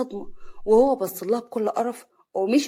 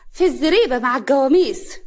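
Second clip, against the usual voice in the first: raised, loud sneering lilt; sarcastic; 4 semitones higher, 8 dB louder than usual. A narrator's reading. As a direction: announce it with mocking relish. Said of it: "كريمة بسخرية". (بسخرية واستهزاء)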